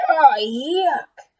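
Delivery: loud, high yuck; grossed-out parent shout; disgusted shout. Acted. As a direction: recoil in disgust loudly. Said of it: "They shouted".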